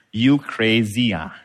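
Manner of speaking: drawn-out Singlish with a slow tempo; exaggerated Singaporean accent. Shown as a demonstration. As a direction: stretch it out in a broad Singaporean English. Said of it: "Singaporean English is like this".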